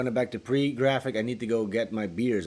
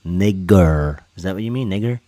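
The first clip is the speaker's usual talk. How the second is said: loud test shout; 2 semitones lower, 12 dB louder than usual. Pretend. Mocking chat, mic test.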